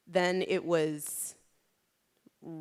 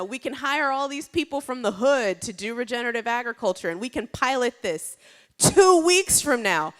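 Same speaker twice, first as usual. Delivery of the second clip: insistent pitch, raised and loud; high, urgent sales push; pushy urging; 5 semitones higher, 8 dB louder than usual. Retold or imitated. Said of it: "I kept pushing Rebecca".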